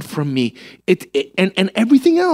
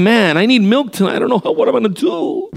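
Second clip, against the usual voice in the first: higher-pitched mock fretting over milk; panicking shopper; 7 semitones higher than usual. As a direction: fret helplessly, hammed up. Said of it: "standing in the middle of a supermarket and saying".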